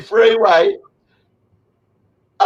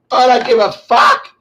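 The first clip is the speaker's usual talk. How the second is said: usual speaking voice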